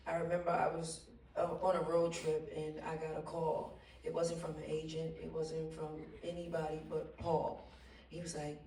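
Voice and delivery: usual speaking voice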